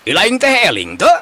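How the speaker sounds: indignant scolding. Acted. Vendor scolding the man who lost his stock, indignant. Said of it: "ngadaregdeg manehna ngomong nyarekan".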